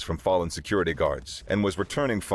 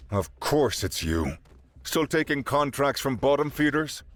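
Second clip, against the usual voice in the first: weary sigh; 7 semitones higher than usual. Acted. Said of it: "Jaden sighed".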